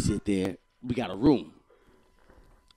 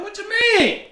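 very high, loud, defensive yelp; raised voice; 9 dB louder, slower than usual. Retold or imitated. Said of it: "I just had to raise my voice".

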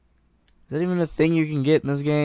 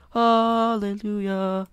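higher, slow, drawn-out hallelujah; real relief at the bandage; relieved hallelujah; 7 semitones higher, slower than usual. Real, not acted. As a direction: sing out your relief. Finally found a bandage.

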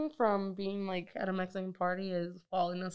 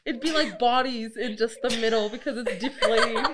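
ordinary delivery

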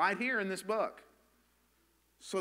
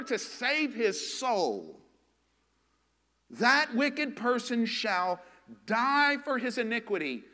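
forceful preaching; 4 semitones higher, 4 dB louder than usual. Real, not acted. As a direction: drive the warning home, pressing each phrase. Preacher drives the warning home.

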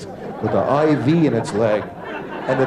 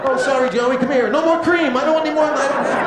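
much higher, embarrassed sing-song to the dog; sheepish apology; 14 semitones higher than usual. Acted. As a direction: talk to the dog like a caught, flustered kid.

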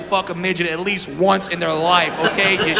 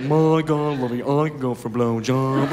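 a hint of a refined New England man; mock Connecticut posh; 7 semitones lower, 3 dB quieter than usual. Guy in Connecticut asking, lightly voiced Connecticut. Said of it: "Go up to Connecticut".

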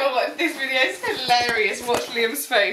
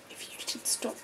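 whispered, barely voiced; 2 semitones lower, 13 dB quieter than usual. Real, not acted. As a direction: say it under your breath. Whispered while filming.

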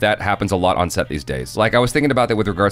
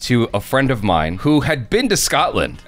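normal talking voice

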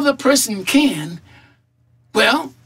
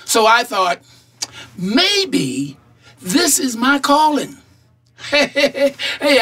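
ordinary delivery

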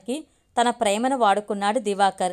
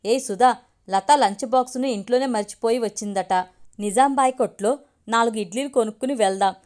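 ordinary delivery

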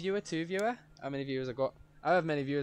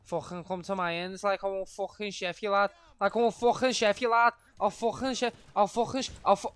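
put-on Scouse, rising higher and louder; Scouser accent; 6 semitones higher, 4 dB louder than usual. Streamer going Scouser mode with threats, mock Scouse accent. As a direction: build up a hammed-up Scouse threat. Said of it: "We're going Scouser mode".

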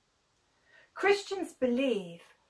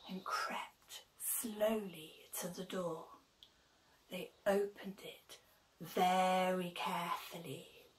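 whispered narration; 5 semitones lower, 13 dB quieter than usual. A narrator's reading.

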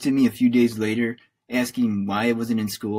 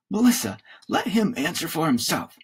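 raised-pitch reprimand; said loudly; 7 semitones higher than usual. A narrator's reading. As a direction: cut her off, voice slightly raised. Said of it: "Dad said loudly".

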